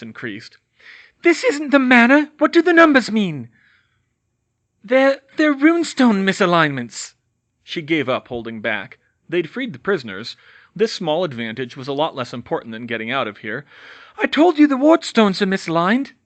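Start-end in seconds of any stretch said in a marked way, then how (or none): 1.2-3.5 high, loud witch; manic interrogation. Acted. Bellatrix interrogating. 4.8-7.1 higher, loud stammer; stammered answer. Acted. Hermione stammering under weight. 14.2-16.1 higher, louder insistence; strained insistence. Acted. Hermione insisting.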